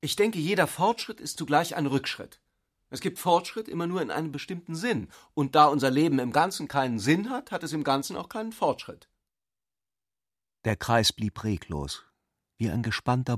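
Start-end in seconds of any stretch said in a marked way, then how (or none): 0.0-9.1 raised pitch, earnest and sweeping; a student preaching his pessimism; lightly voiced young zealot. A narrator's reading. Hans Sepp declares to the circle, higher and earnest.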